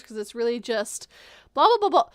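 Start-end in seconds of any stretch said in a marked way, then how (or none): none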